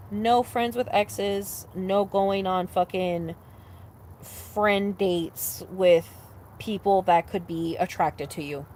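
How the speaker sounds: slow and deliberate, word by word; slow emphatic rules. Real, not acted. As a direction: spell out the rules slowly. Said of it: "laying down rules slowly".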